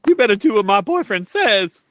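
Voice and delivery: high mock threat. NPC voiced by player.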